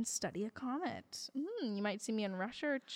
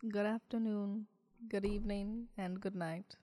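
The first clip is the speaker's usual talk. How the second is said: monotone voice; 4 dB quieter than usual. Acted. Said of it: "I'm in this monotone voice".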